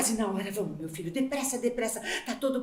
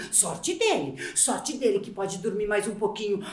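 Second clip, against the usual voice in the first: lower, softer envious grumble; grudging envy; 7 semitones lower, 5 dB quieter than usual. Acted. Tired mother envying sleeping husband, low grumble. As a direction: mutter it with a bit of envy.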